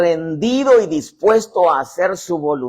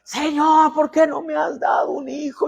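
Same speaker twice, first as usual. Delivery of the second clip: tearful crying out; 11 semitones higher than usual. Acted. Ana crying out to God.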